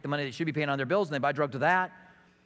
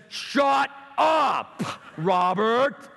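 loud, fed-up shout; exasperated shout; 4 semitones higher, 3 dB louder, much slower than usual. Retold or imitated. Friend fed up with the teasing, shouting loudly. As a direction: bark it at a friend who won't quit. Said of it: "Finally, Pat says".

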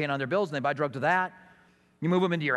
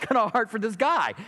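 normal talking voice

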